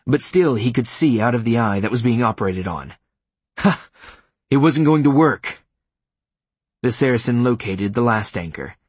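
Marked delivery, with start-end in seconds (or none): 3.6-5.6 loud, high exultant cry; raised, urgent cry of triumph; desperate triumph. A narrator's reading. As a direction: burst out in frantic defiance. Klaas's desperate triumphant thought mid torture.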